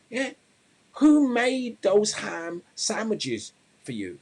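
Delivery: high, slow exasperated challenge; fed-up friend. Acted. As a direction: confront him, drawing out each question. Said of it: "friend's had enough".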